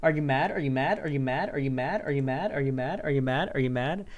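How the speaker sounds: looping taunt, each question swinging up at the end; relentless sing-song needling on repeat; Destiny mock-taunt. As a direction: badger with the same rising question nonstop. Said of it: "imagining Destiny dressed up as Vosh".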